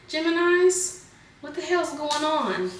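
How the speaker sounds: startled, amused what-the-hell; surprised. Real, not acted. Reader surprised the same card came up again, high and incredulous. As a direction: pitch jumps up in disbelief.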